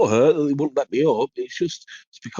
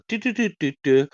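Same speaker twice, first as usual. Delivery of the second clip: toaster jingle; 5 semitones higher than usual. Retold or imitated. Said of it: "it was like".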